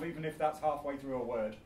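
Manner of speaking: ordinary delivery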